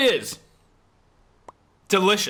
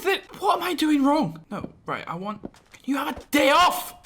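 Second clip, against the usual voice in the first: raised, fed-up outburst; exasperated shout; 7 semitones higher than usual. Real, not acted. Builder yelling at the game that keeps failing, exasperated shout. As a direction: snap at the stubborn game.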